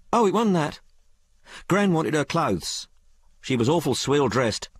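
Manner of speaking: put-on high-pitched, colloquial working girl; working-class maid Sal. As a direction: tell it as an uneducated maid confiding in her mistress. Story figure Sal.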